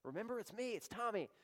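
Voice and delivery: high, pleading voice of a desperate man; a high, whiny plea at judgment day; pleading churchgoer. Retold or imitated. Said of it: "They're gonna say".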